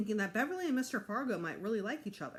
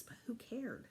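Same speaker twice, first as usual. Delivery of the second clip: hushed happy aside; whisper; 4 semitones higher, 9 dB quieter than usual. A narrator's reading. Narrator privately glad about greasy fingers, whispered. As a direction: whisper it to yourself.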